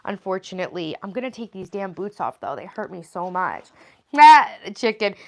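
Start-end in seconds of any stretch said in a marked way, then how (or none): 4.2-5.2 loud, slow exclamation; surprised. Real, not acted. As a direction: blurt out the discovery. Sudden exclamation.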